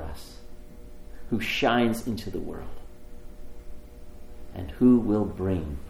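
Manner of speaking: measured prayer in short phrases with long pauses. Real, not acted. Preacher asking God for light and healing, prayerful.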